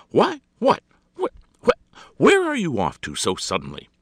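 high, loud stammered questions; taken aback and demanding; startled. A narrator's reading. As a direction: splutter in astonishment. Startled by his sudden good-bye.

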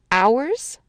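rising, questioning "Ours?"; surprised question. Acted. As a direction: catch on the word "Ours" and query it. She questions the word.

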